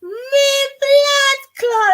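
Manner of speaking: slow, high, loud Jamaican patois exclamation; mock Jamaican accent. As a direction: stretch out blood clot in a put-on Jamaican voice. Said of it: "I can get a Yankee accent".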